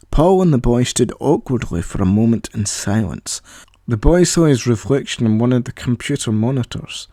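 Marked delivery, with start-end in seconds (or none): none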